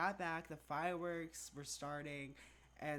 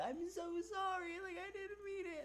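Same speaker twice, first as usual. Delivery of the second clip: very high mock sobbing apology; imitating a crying friend apologizing, high and wailing; 14 semitones higher than usual. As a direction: wail it like a terrified friend in tears.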